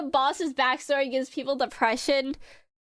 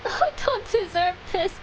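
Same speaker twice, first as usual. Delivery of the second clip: real tearful crying, voice breaking. Real, not acted. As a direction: cry through the words. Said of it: "I'm sorry, I'm crying".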